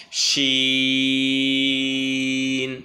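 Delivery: "শিন" with a very long, level "ee" closing on n; drawn-out letter name. Shown as a demonstration. Teacher showing how the letter begins and ends, long steady "শিন". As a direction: hold "শিন" on one steady note. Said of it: "শুরুটা হবে এভাবে".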